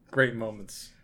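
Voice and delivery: normal talking voice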